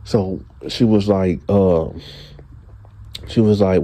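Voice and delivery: ordinary talk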